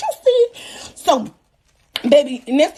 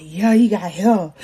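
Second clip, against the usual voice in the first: low, slow answer; lightly put-on man's voice, pitched low; imitated baby daddy; 7 semitones lower, slower than usual. Baby daddy answering her about the hair, imitated low voice.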